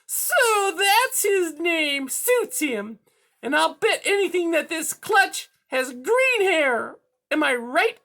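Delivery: high, loud, gloating cat villain; purr-free piercing villain voice, pleased with itself; high-pitched menacing cat; scheming cat. A narrator's reading. Cat gloats over the news.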